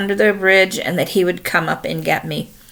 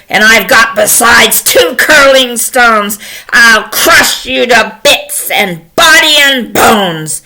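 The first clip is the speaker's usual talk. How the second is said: loud, slow, booming threat; fierce goat warrior, raised and thunderous; big billy goat; 5 semitones higher, 7 dB louder, slower than usual. Acted. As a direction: chant the challenge at full force. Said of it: "along comes Big Billy Goat Gruff".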